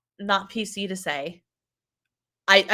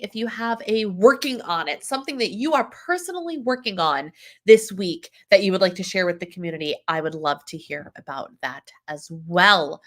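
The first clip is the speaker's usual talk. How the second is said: ordinary delivery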